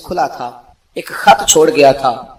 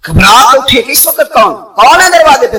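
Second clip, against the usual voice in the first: loud, higher alarmed cry at a midnight knock; startled alarm; 6 semitones higher, 9 dB louder than usual. Retold or imitated. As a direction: call out in sudden alarm. (گھبراہٹ میں اونچی آواز)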